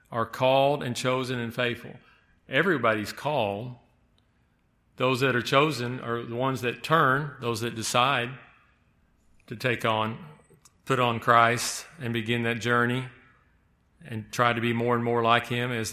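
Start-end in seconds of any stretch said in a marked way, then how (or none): none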